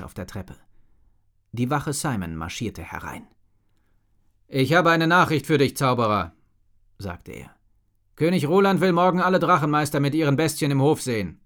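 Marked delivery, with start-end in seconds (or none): 4.5-11.4 loud, formal palace guard. A narrator's reading. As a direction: announce the king's order firmly. Guard delivers royal message.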